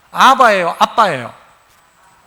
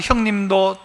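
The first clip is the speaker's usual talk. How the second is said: normal talking voice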